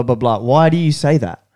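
ordinary talk

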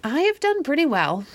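grumbling, high and dissatisfied; discontented. A narrator's reading. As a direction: sound unsatisfied with your riches. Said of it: "in a discontented tone".

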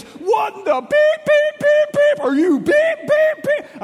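high, loud shouted bleeped curses; hammed-up furious yelling of censored swears; cursing cops. Angry undercover officers yelling at the pastor, mock bleeped cursing. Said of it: "They are swearing in w".

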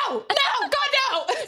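high frightened mock wail; panicked pleading. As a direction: squeal it like a scared man. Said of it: "he's like".

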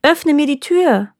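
wife calls to be let in; calling out. A narrator's reading. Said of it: "kehrte die Frau vom Feld zurück und rief".

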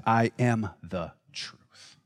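slow, soft, low and sincere; Jesus speaking. Acted. Jesus answering the question slowly, softly and with calm. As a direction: lay each word down gently. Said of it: "when he said".

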